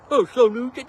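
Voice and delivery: high sneering sing-song; mocking high commenter voice. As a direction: parrot the snide comment. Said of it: "You know, Perkins' house".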